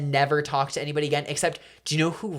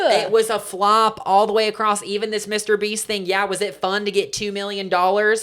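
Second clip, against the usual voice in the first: put-on country accent; 5 semitones higher, 4 dB louder than usual. Fed up with celebrities on show, country accent. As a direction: declaim it with a country twang. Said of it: "I can do a country accent".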